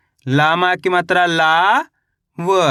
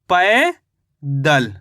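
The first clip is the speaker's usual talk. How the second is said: "पैदल" drawn out, पै rising, pause, दल falling lower; syllable by syllable; 5 semitones lower, much slower than usual. Shown as a demonstration. Teacher sums up the spelling for learners, slowly syllable by syllable. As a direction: stretch पै in "पैदल", pause, then let दल fall. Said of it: "तो मिलके बन गया ये".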